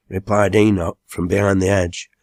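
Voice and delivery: plain reading voice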